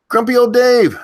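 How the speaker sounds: high, loud shout of the name; screaming a name. Real, not acted. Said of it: "these people that I'm yelling".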